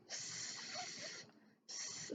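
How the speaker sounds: unvoiced breathy s; whispered sounding out. Shown as a demonstration. Teacher demonstrating sounding out, whispered. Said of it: "Help your slug sound out words".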